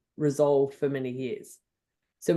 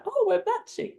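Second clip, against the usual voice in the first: much higher, thrilled little exclamation; delighted squeal. Retold or imitated. Woman noticing her pink jumper matches the flower, delighted squeal. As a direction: squeal at the happy coincidence.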